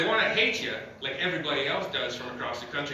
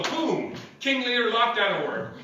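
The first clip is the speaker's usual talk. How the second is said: excited announcement; 8 semitones higher, slower than usual. Acted. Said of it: "award announcement".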